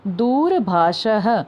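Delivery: दूरभाषः very slowly, visarga as "ha"; model pronunciation. Shown as a demonstration. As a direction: draw out दूरभाषः to a falling final "ha". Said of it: "उनको भी उच्चारण करना है".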